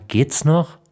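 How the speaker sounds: höher und lauter empört; empörter Ausruf. Acted. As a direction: fahr ihn empört an. Vater empört über den Jungen. (empört, höher und lauter)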